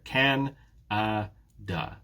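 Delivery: "Can-a-da" very slowly, a gap between each beat; syllable by syllable. Shown as a demonstration. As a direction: split "Can-a-da" into three separate beats. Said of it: "you can see the breakdown here".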